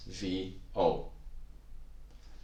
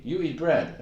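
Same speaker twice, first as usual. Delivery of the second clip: higher, louder acted statement; telling somebody; 7 semitones higher, 4 dB louder than usual. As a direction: tell someone brightly as a plain fact, pitched up. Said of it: "you say to somebody".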